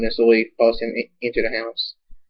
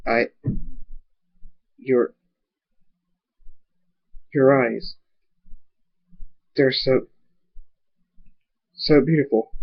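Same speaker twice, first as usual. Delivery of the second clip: halting, with long pauses between words; smitten faltering. A narrator's reading. Guard to the stallion he admires, haltingly smitten. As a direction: falter and restart, lost for words.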